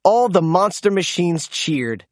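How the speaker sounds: usual speaking voice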